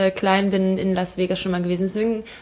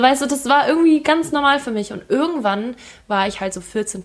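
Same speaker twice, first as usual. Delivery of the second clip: usual speaking voice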